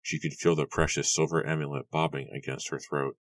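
ordinary delivery, nothing marked

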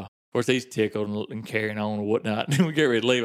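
ordinary delivery

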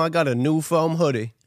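ordinary talk, nothing marked

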